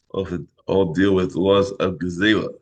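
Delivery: normal talking voice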